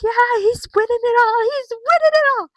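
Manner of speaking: high, loud hype-man shout; hyped Paul Heyman impression. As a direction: yell it like a ringside hype man.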